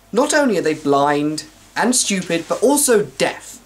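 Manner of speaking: ordinary talk, nothing marked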